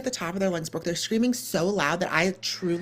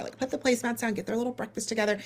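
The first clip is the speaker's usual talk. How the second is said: ordinary delivery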